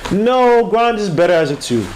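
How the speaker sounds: high, loud no; real firm objection; emphatic no. Real, not acted. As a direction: shut the idea down hard. Emphatic disagreement.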